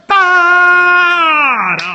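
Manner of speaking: high, loud ta-da flourish; fanfare shout. Acted. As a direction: belt out the big finish. Fanfare ending theme.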